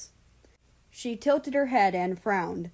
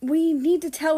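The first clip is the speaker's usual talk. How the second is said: pestering; 8 semitones higher, 7 dB louder than usual. Acted. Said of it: "Tori pestered".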